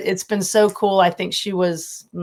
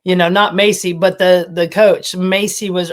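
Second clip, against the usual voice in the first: ordinary delivery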